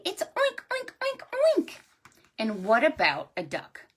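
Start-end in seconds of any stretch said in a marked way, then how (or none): 0.2-1.7 very high little oinks; pig oinking. Shown as a demonstration. Storyteller showing children a pig's sound, high oinking. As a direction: squeal out a string of piggy oinks. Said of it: "what sound does a pig make?".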